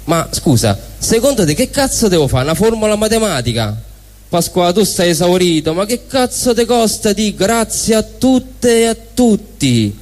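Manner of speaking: ordinary delivery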